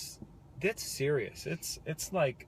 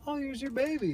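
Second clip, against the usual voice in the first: high, bright mock nurse voice; mock cheery nurse; 12 semitones higher than usual. Husband mimics the nurse presenting the baby, high mock cheer. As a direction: imitate the nurse handing the baby over.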